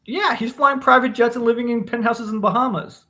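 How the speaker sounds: normal talking voice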